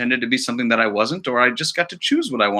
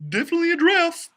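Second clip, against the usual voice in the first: much higher, fast confirmation; squeaky crew voice; faster than usual. Acted. A crew member confirming the giraffe, quick and high. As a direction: blurt it out nervously.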